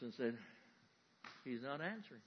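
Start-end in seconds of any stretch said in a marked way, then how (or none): 1.4-2.2 exhausted. Acted. Prophets collapsed.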